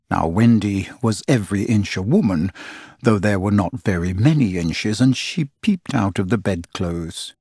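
ordinary delivery

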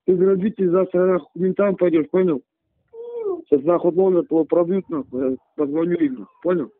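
angry threats through a phone line; furious man on a tinny phone; angry over the phone. Real, not acted.

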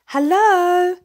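high, loud bright greeting; cheerful hello. A narrator's reading. Friendly foxes greeting little bear, high and loud. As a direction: call out to a passing friend.

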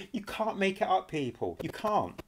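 amused laugh of disbelief. Real, not acted. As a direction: chuckle through the words. Laughing at the clip.

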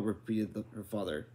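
ordinary delivery

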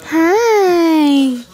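loud, high happy hi; excited greeting. Real, not acted. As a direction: greet the puppy with delight.

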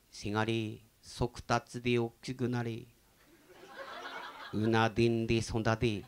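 slow, solemn poetry reading; poem recitation. Shown as a demonstration. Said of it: "詩の朗読をしてるんですから。".

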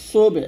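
"সুবে" with an echoing bounce on বে; clear qalqalah. Shown as a demonstration. Teacher showing where qalqalah is compulsory, clear bounce. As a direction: let the ব in সুবে rebound audibly. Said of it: "এখানে অবশ্যই কলকলা করবেন".

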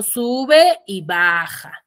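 "Sube y baja" drawn out slowly, up on sube, down on baja; slow, stretched "Sube y baja" that climbs and descends; rising then falling tune. Shown as a demonstration. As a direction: trace a curve with the voice on "Sube y baja". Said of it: "con esa curvita".

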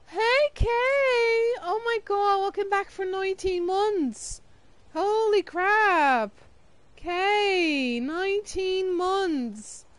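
high, thrilled greeting with long drawn-out words; excited welcome. Real, not acted. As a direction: squeal hello to a long-missed friend, stretching the vowels. Greets returning subscriber of nineteen months.

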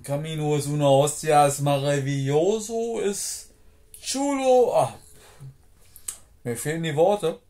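loud, high rapturous cry; excited shouting. Real, not acted. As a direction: rave at the top of your voice. Raving about the Camino.

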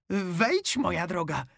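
the wolf pretending to be grandma; wolf in high voice. A narrator's reading. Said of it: "zawołał wilk wysokim głosem". (wilk udaje babcię wysokim głosem)

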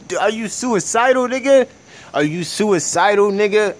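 high, loud disbelieving shout; incredulous yelling. Real, not acted. Ranting at reckless people crossing roads, loud disbelief. Incredulous rant at bad drivers.

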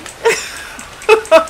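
airy laugh. Real, not acted. Laughing at the game.

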